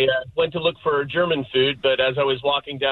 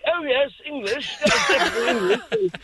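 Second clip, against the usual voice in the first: put-on posh British accent; 10 semitones higher than usual. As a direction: play an old English gent with a pipe. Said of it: "an eighty-year-old British guy with a pipe".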